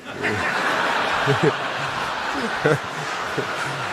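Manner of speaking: quiet chuckle at his own joke; a small laugh after the punchline; soft laugh. Real, not acted. Man chuckling after telling his dad's joke, soft laugh.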